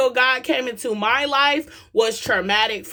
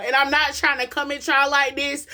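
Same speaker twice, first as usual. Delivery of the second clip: raised, urgent preaching voice; 5 semitones higher than usual. Acted. Preacher urging parents to hear their kids, raised and urgent. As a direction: preach it higher to wake the crowd up. Preaching to the crowd about healing.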